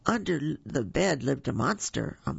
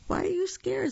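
much higher, friendly monster voice; a cheery young monster asking; smiling stranger. A narrator's reading. Spike asks the hiding Boo, high and friendly. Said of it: "smiled the stranger".

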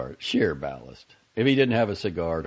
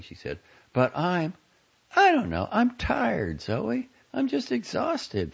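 put-on high, worn-out young woman pleading; a tired young woman close to tears, higher; Franny exhausted. A narrator's reading.

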